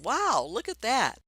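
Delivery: high, loud happy exclamation; exclaiming. Shown as a demonstration. Teacher showing an exclamation point, high loud exclaiming. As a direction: burst out as if amazed. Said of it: "I'm exclaiming".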